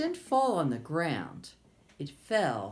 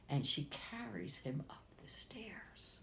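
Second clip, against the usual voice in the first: 1 whispered narration; 10 dB quieter than usual. A narrator's reading. Quiet narration bedtime.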